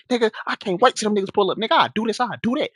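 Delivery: high-pitched mimicry of big threats; imitated crazy talk. Neighbor outside boasting before they pull up, imitated crazy talk. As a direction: play up his worked-up bravado. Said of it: "like, talking crazy".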